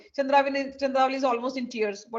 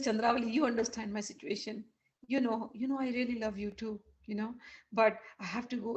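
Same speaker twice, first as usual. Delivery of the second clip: softly reasoning and tender; gentle placating; 7 dB quieter than usual. Acted. Krishna placating Chandravali before leaving, soft and gentle. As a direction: quietly ask for understanding.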